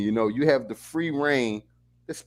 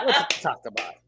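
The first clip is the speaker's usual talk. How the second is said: laughing; 5 semitones lower than usual. Pretend. Laughing Jamaican bit.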